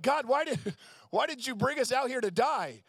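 high, whining cry at God; whiny complaint. Retold or imitated. Israelites in the desert whining to God, high and pleading. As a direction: whine like a stranded grumbler blaming God.

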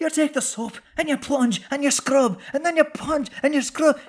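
very high, hammed-up Irish accent; exaggerated Irish movie-accent imitation; Cruise's Irish accent. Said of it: "like, really hammering that accent".

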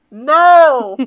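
loud call from another room. Pretend. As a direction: shout it from the next room. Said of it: "From the other room".

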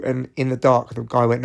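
usual speaking voice

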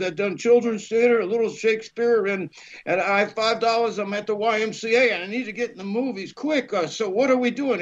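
high-pitched, breathless-to-impress résumé; eager sales pitch. Retold or imitated. Young actor begging to get into the movies, eager and high-pitched. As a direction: reel off your credits eagerly, pitched high.

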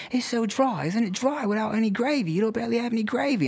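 high mock voice of the London hosts; mimicking the hosts. Retelling the promoters insisting his food is too dry, high mocking voice. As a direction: parrot them in a high fussy voice. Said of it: "And they were like".

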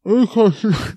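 muffled, wordless mock cries for rescue; gagged hostage noises. Showing his masked voice as a gagged hostage, muffled cries. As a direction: imitate pleading through a gag. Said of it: "um refém amordaçado pedindo resgate".

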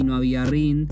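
ordinary talk

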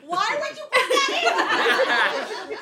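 slow, drawn-out high wail of outrage; real yelling. Real, not acted. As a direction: stretch out every word in laughing exasperation. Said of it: "I'm yelling into the mic".

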